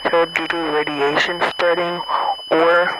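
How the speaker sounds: usual speaking voice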